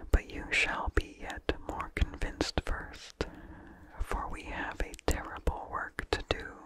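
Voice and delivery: usual speaking voice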